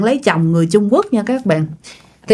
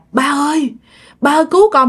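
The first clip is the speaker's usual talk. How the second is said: high, pleading call for help, raised; terrified plea; 6 semitones higher, faster than usual. Retold or imitated. Mother pinned by ghosts begs her late father, high frightened plea. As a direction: beg a dead father to save you. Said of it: "Cái mẹ mới kêu rằng".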